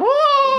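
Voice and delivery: very high mock scream; imitated scream. Comic retelling of the man on the United flight, high mock scream. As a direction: screech it out, imitating the passenger.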